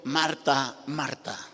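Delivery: soft, low mock call from afar; a shout retold at very low volume; faint, faraway-sounding name call; imitated distant call. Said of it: "desde lejos me gritó".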